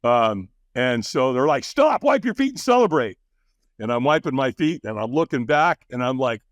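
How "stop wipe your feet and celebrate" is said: higher-pitched, excited calling out; retold urgent call. Helpers greet a finished firewalker, excited retold call. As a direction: imitate helpers calling to someone coming off the coals.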